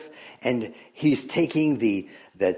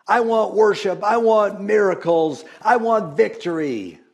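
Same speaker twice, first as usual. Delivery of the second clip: higher, louder, insistent demands; demanding self; 9 semitones higher, 5 dB louder than usual. Retold or imitated. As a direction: plead loudly like an impatient believer. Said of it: "I'm thinking about myself".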